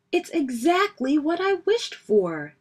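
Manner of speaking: high-pitched, delighted burst; excited. A narrator's reading. Brother getting the bike he wished for, excited. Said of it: "said Brother excitedly".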